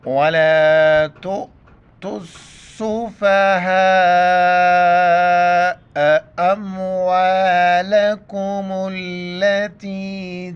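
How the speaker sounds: slow, drawn-out melodic tilawat; Quran recitation. Acted. Teacher reciting the verse for students, slow melodic recitation. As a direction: chant each word long and ringing.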